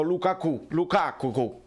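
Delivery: "Lukaku" tried with shifted stress; alternative mispronunciations. Shown as a demonstration. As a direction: distort "Lukaku" as examples of wrong stress. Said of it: "non l'avete mai chiamato". ("Lukaku" con accenti sbagliati, per esempio)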